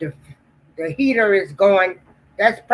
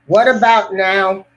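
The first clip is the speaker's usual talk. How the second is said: normal talking voice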